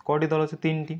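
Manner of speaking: ordinary talk, nothing marked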